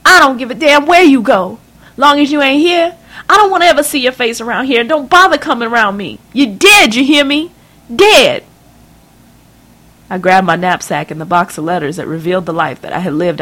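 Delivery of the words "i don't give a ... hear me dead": high, loud enraged mother's voice; a scandalised mother tearing into her daughter; shrill rising tirade, higher and louder; furious mother. Acted.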